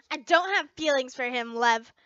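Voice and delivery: loud, firm denial; insistent denial. A narrator's reading. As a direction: shut her down firmly. Insisting to friend.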